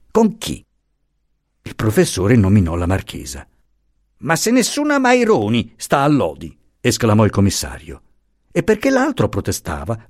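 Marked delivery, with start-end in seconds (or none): 4.2-6.6 higher, scornful official; lightly voiced insolent commissioner. A narrator's reading. Commissioner scoffs at the professor's story, mildly scornful. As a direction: dismiss him with contempt.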